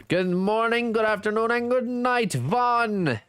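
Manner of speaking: greeting sung up high and bright; a lilting all-times-of-day hello to Vaughn; sing-song greeting. Real, not acted. Greeting viewer in all timezones.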